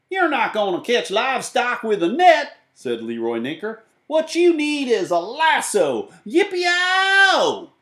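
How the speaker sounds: high loud cowboy voice building to a whoop; brag, then holler yippee; high slow cowboy ending in a loud yell; cowboy Leroy. Acted.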